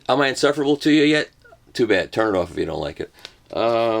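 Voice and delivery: normal talking voice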